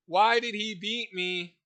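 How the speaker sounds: high, slow, whiny complaining voice; whiny kid. Retold or imitated. [name] mocking a whiny recruit, high and slow whining. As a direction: whine it like a sulking little kid.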